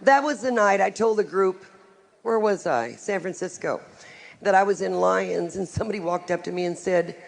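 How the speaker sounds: usual speaking voice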